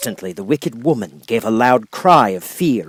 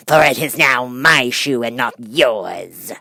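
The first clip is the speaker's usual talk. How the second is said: witch retorting; slower than usual. A narrator's reading. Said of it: "retorted the witch".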